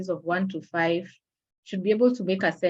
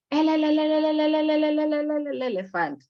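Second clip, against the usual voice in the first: much higher, louder, le le le then elephant; stuttering child syllables; 9 semitones higher, 4 dB louder than usual. Shown as a demonstration. Mother imitating her daughter's repeated syllable, high and loud. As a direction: imitate a toddler stuck on one syllable. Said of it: "she would say".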